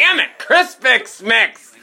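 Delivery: yell, exasperated; frustrated yell. Real, not acted. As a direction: shout it in frustration, slow on the name. Frustrated yell after stumbling.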